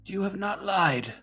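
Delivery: high, slow, far-off seer voice; high floating seer, slow and solemn; Ada the Seer. Acted. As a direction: speak like an ancient oracle, drawn out and lofty.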